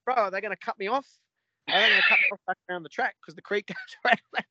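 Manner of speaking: high, fast, imitated panic; panicky, shivering. Hiker fearing the creatures cut him off, imitated high panic. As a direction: rush it like he's terrified of being cut off. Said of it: "he's the shivering in his voice".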